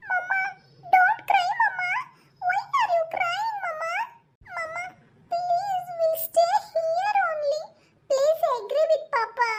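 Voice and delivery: put-on high, slow, pleading child; a small daughter begging her crying mother; sweet little girl pleading with mama. Child begs mother to stay.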